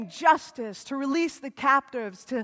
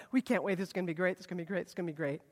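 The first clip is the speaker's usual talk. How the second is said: muttered anticipation; 7 semitones lower, 8 dB quieter, much faster than usual. Retold or imitated.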